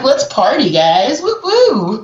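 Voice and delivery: high whooping party call; party cheer. Retold or imitated. Hyping the group at a location, whooping cheer. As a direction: whoop the room up. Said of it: "I'm like".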